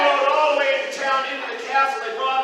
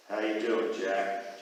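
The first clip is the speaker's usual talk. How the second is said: much lower, quiet royal greeting; deep king voice; 4 dB quieter, slower than usual. Acted. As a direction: greet him low like the king.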